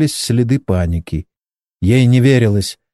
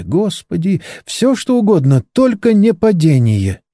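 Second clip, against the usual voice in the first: higher, pleading and frightened; panicked prayer; 8 semitones higher than usual. A narrator's reading. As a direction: beg God not to let them crash. Panicking passenger prays aloud. (испуганная мольба)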